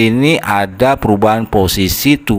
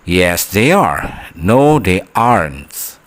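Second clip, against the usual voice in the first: slow model answers; much slower than usual. Shown as a demonstration. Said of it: "teacher models the short answers".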